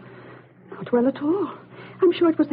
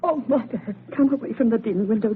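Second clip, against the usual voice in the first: usual speaking voice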